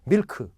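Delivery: 밀크 as two full syllables, mil-keu; Korean-style 밀크 with both syllables clear; every letter sounded. Shown as a demonstration. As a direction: sound out the final 크 in 밀크. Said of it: "엠 아이 엘 케이가 다 들렸잖아요".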